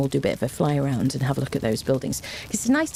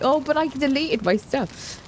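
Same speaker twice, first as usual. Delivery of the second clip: whiny viewer; 9 semitones higher than usual. Retold or imitated. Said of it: "I'm sorry if you're like".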